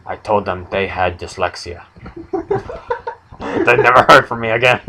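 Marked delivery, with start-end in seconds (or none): 2.3-4.9 laughing. Real, not acted. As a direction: laugh your way through the line. Laughing through own joke.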